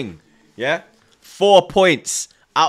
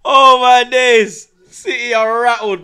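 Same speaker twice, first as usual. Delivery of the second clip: exclaiming; 5 semitones higher, 6 dB louder, slower than usual. Real, not acted. Laughing at rattled rivals.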